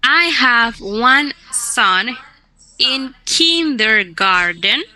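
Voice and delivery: very slow, clear model reading; slow, deliberate model sentence. Shown as a demonstration. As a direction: read it out slowly for the class.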